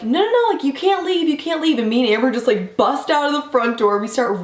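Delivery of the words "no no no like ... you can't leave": pleading protest. Retold or imitated. Jake's mom begging the girls not to leave, high mock plea. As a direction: play the host's mom begging them to stay. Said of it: "Jake's mom is like".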